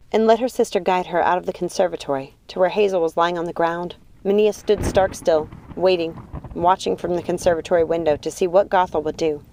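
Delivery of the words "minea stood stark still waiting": ordinary delivery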